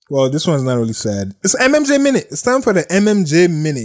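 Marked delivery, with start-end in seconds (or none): 1.4-3.8 high, bright-toned jingle announce; higher announcer call for the segment. Acted. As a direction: sell it like a show segment.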